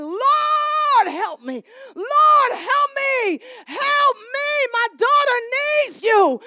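high, loud, pleading shout; crying out to the Lord. Acted. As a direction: cry for help as the desperate mother.

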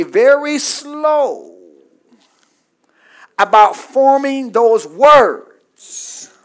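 drawn-out key words; measured, lingering emphasis; slow, deliberate. Shown as a demonstration. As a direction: stretch the words out slowly and deliberately. Acting out being slow to speak.

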